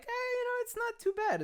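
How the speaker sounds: high-pitched, noncommittal shrug; half-hearted approval. Retold or imitated. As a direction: squeak out a reluctant defence. Own hesitant reaction to the trailer.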